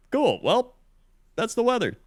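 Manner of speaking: weather anchor sign-off. Pretend. Segment sign-off, high pitch.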